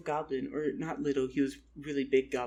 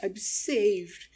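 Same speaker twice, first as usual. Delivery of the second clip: higher, louder burst of relief; mock relief; 6 semitones higher, 5 dB louder, slower than usual. Lost traveler spotting a village, elated relief. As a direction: exclaim it like a rescued castaway.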